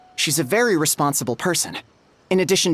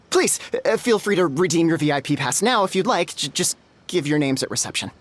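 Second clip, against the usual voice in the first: normal talking voice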